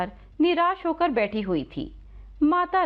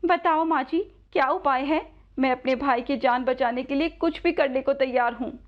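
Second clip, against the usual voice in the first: put-on higher, earnest girl's voice; a sister pleading, pitched up; eager young Radha; 3 semitones higher than usual. Radha begs old woman for a remedy, earnest higher voice.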